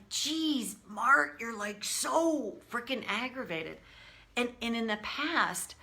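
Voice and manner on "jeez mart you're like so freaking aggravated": imitating an exasperated husband, higher pitch; retold irritated outburst at a partner; passionate retort. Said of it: "he likes to say passionate in his response".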